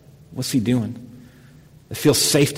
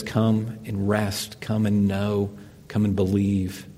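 gentle invitation; 3 semitones lower, 7 dB quieter, slower than usual. Retold or imitated. God's gentle invitation voiced.

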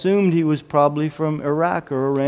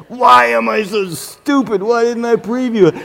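pitched-up, mock anguished self-scolding; exasperated self-reproach; 6 semitones higher than usual. Pastor kicking himself over an unpreviewed film, mock anguish. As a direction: berate yourself, half-wailing. Berating himself in retold thoughts.

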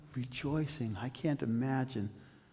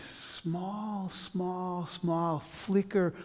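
drawn out; 3 semitones higher, much slower than usual. Real, not acted. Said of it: "stretching small slowly".

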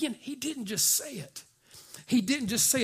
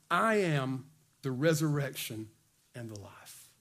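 measured, falling to a low hush; weighty declaration, pausing before the last words; solemn scripture quote; 5 semitones lower, 4 dB quieter than usual. Acted. Preacher reads Jesus' words solemnly.

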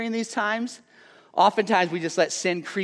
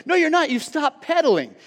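higher, louder exasperated brother; frustrated brother; 9 semitones higher, 3 dB louder than usual. Retold or imitated. Brother on the tandem calls out his sibling, louder and higher. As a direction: snap back at the slacking stoker. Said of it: "so frustrated and upset".

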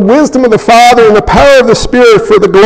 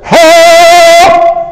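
sudden cry for help; 7 semitones higher than usual. Pretend. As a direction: yell it out of nowhere, surprising everyone. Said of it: "Jim just stood up and said".

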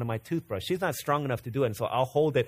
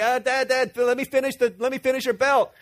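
put-on excited toddler; 10 semitones higher, 5 dB louder than usual. Excited toddler daughter running in.

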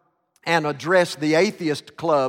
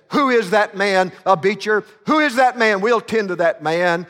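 louder, higher indignant demand; indignant outburst; 3 semitones higher, 4 dB louder than usual. Retold or imitated. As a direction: demand who did it, outraged. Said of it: "filled with indignation".

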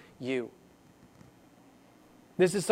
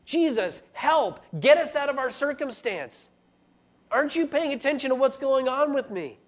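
higher, urgent cry for help; panicked plea; 7 semitones higher than usual. Retold or imitated. Frightened disciple begging Jesus for help, higher and urgent. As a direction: beg Jesus to wake up and save you. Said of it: "the disciples are crying out to Jesus".

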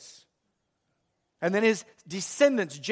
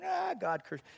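high, softer mimicked dismay; mock alarm; 9 semitones higher, 9 dB quieter than usual. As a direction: imitate a listener recoiling.

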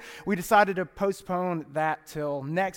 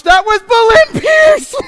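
much higher, louder shocked shout; horrified realization; 14 semitones higher, 15 dB louder, slower than usual. Real, not acted. Pastor realizing he sounds like his mother, shocked shout. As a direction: yell it in horror at yourself. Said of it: "I just turned into my mother".

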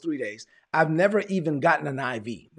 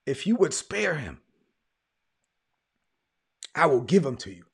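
holding back real emotion, long halting pauses; emotional, stopping to gather yourself mid-sentence; choked up. Real, not acted. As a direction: fight through the feeling, haltingly. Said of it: "I got a little emotional".

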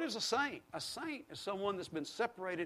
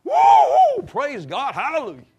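a high whoop breaking into loud praise; sudden joyous hallelujah, loud and high; joyful whoop; 9 semitones higher, 16 dB louder, slower than usual. Retold or imitated. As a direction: shout it out like a happy Baptist. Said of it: "but I just went".

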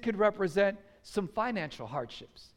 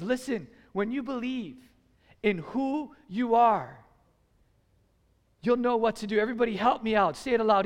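normal talking voice

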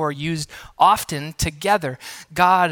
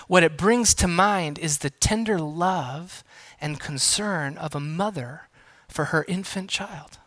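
ordinary talk, nothing marked

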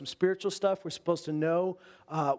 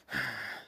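heavy sigh; 8 dB quieter than usual. Acted. Pressure on the wise men.

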